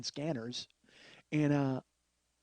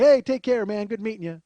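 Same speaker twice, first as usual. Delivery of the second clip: loud, high, breezy goodbye imitation; cheerful farewell; 5 semitones higher, 8 dB louder than usual. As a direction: call out a mock-cheery send-off. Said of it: "he's this cheerful about losing".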